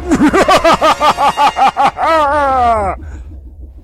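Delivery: long loud evil cackle; maniacal cackle. Acted. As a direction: cackle like a madman. Mad scientist cackles.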